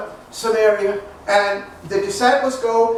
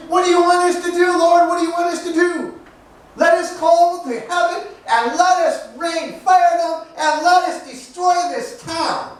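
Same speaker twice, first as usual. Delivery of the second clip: higher, agitated pleading for vengeance; upset disciples; 5 semitones higher than usual. Acted. Disciples rejected by the town appeal to the Lord, upset. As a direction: rail to the Lord in hammed-up outrage. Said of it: "they're all upset".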